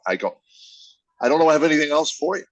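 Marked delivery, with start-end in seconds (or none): 1.2-2.4 higher, louder, rushed protest; retold exasperation. Husband retelling his answer to his tired wife, exasperated. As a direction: blurt it out fast and loud, re-enacting it.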